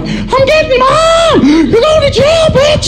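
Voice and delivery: put-on very high, slow, screaming kid; little brother shrieking at big brother that he's telling mom; shrieking tattletale. Same threat repeated.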